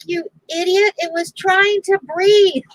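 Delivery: high-pitched imitation of a husband's scolding; mock exasperation. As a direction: scold in retold disbelief.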